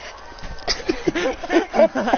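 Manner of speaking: high-pitched villainous laugh; mocking laughter. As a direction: laugh and point at the victim. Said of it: "just, like, laughing".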